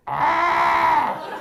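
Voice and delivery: long, high, loud shriek; horrified scream. Retold or imitated. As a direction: imitate a shriek of horror from the bathroom. Acted scream after bathroom warning.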